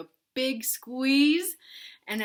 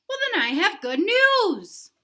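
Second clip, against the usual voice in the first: higher, louder bright news; much higher upbeat voice; cheerful Piggy; 11 semitones higher, 12 dB louder than usual. Acted. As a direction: announce happily.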